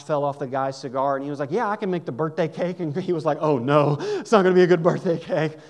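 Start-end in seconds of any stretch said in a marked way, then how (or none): 1.5-5.6 loud, eager burst of agreement; excited loud reply. Retold or imitated. Gruff cook eagerly agrees to bake the cake, loud and excited. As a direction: blurt it out, suddenly keen.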